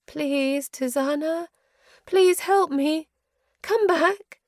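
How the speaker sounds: high, loud, slow pleading; desperate plea. A narrator's reading. Mother's ghost begging her daughter to return, high and desperate. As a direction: beg her to come back.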